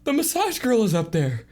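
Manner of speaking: high falsetto, stammered start; comic high-pitched alarm; shaken Moo. Acted. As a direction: report it in a squeaky, rattled voice. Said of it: "he says".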